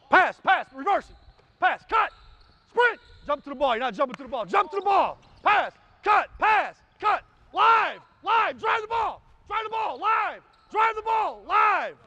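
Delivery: real shouting to drive the drill; coach yelling. Real, not acted. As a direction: bark every cue like a maniac. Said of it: "kind of a maniac in this deal".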